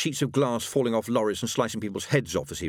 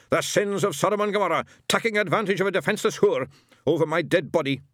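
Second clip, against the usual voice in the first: higher, louder Scottish tirade; shouting outrage; 9 semitones higher, 4 dB louder than usual. A narrator's reading. Shadwell storming through the curtain, shouting outrage. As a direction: bellow it like a witchfinder.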